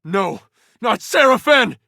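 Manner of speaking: high-pitched cry of loss; dismayed cry. Acted. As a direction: protest a comrade's fall. Companion falls in battle.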